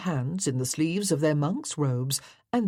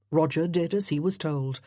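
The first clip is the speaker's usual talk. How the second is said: ordinary delivery, nothing marked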